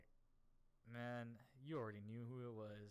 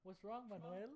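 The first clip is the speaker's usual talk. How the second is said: cheeky greeting; 9 semitones higher than usual. Retold or imitated. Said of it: "look up, smile".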